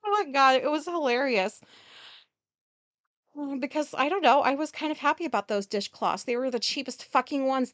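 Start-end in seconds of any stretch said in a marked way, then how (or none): none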